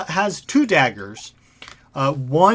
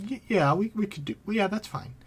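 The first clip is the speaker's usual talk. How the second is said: soft, higher-pitched hesitant murmur; hushed; 6 semitones higher, 6 dB quieter than usual. Acted. Mercenaries agree among themselves to the deal, hushed. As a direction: agree under your breath, haltingly. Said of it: "some, like, hushed".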